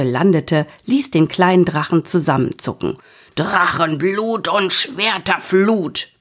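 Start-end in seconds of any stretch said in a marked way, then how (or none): 3.4-6.1 mock dragon teacher roar. A narrator's reading. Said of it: "brüllte der Drachenlehrer".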